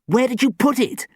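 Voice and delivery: high, loud, sharp demand; sharp high-pitched interrogation; sharp accusation. A narrator's reading. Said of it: "Sam hissed sharply".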